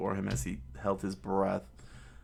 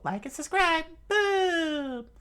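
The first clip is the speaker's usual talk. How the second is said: very high, squeaky cartoon voice; a tiny shrieking influencer; screaming YouTuber balls; 5 dB louder, slower than usual. TikTok ball hits Chopper.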